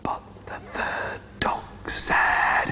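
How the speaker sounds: slow, suspenseful stage whisper; hushed build-up. A narrator's reading. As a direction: whisper it slowly, setting up a surprise. Hushed build-up to big quack.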